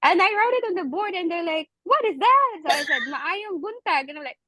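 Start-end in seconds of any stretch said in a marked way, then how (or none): 1.8-2.5 higher, louder, mildly baffled question; puzzled students. Retold or imitated. Students seeing Bisaya written on the board, acted puzzlement. 3.0-4.1 "Maayong buntag" slowly with native Bisaya open a vowels; Bisaya accent. Retold or imitated. Teacher answering puzzled students, "Maayong buntag" in native Bisaya accent. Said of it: "we really have a thick accent".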